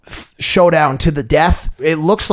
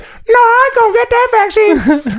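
mock Susie; 3 dB louder than usual. Susie answers the newscaster in a hammed-up high caricature voice. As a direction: squeak it out like a silly caricature. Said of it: "Susie, what do you have to say?".

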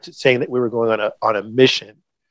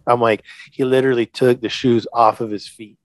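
normal talking voice

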